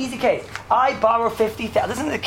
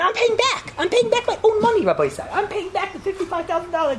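voicing the borrower; 10 semitones higher than usual. Borrower voiced in a case.

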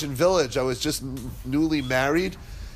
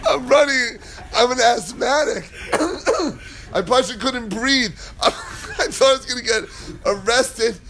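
higher voice, breaking into laughter; can barely get it out for laughing; laughing while telling; 7 semitones higher than usual. Real, not acted. Telling the congregation about the chase, laughing hard.

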